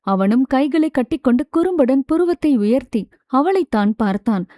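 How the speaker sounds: usual speaking voice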